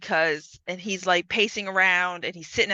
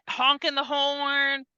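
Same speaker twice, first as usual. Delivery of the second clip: usual speaking voice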